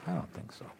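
whispered dismissal; whispered aside. Real, not acted. Preacher whispering a doubtful aside. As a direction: breathe it out dismissively.

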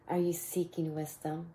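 ordinary talk

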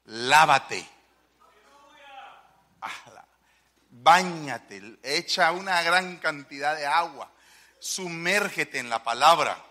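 loud, firm commands, the last one slow; preaching command. Real, not acted. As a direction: charge them to wash in the word. (mandato predicado, fuerte)

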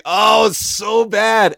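slow, loud groaned complaint; mock dismay. A fan groaning about a bad accent, slow and loud. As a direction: drag it out like a disgusted critic. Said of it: "I was like".

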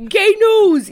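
high, loud announcer call; hammed-up jingle shout. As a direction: belt the segment name like a jingle. Announcing the segment.

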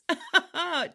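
a short burst of real laughter, dropping at the end. Real, not acted. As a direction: laugh out loud at the cheeky line. Host laughs at own joke.

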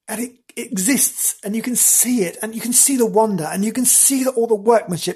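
high, building rush of wonder; breathless enthusiasm. Real, not acted. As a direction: pile up the reasons excitedly. Urging listeners to go see it.

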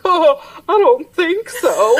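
high, slow reply through laughter; amused imitation; laughing mock reply. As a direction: giggle out the teen's answer.

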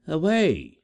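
high, loud cry of command; proud girl crying out; cried out. Acted. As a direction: fling the word at her rival. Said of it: "cried the lofty one".

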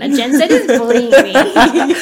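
loud and slow through laughter; laughing mock whine. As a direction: play the wounded victim laughing. Mock complaint while laughing.

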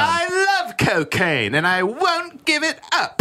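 high, slow hammed-up old man; mock old man voice. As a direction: drag it out high as a silly old dad. Mock John Mahoney voice.

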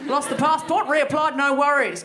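loud, high broad Australian; cheerful broad Australian recap of losing a passport. Shown as a demonstration. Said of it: "I get more Australian even when I talk about it".

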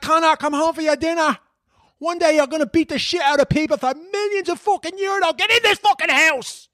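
put-on high-pitched Dublin mam yelling a kid home; Dublin mam calling kid in. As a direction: shout him in for dinner like a Crumlin ma. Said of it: "ma's ... calling their kids to come home for their dinner".

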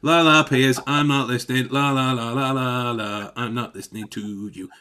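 mock sing-song la la, fading off; lightly voiced child plugging his ears; teasing a politician who won't listen; childish not listening. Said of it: "he may as well be".

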